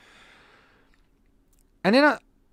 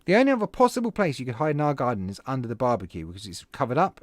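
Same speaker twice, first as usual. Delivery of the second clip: ordinary talk, nothing marked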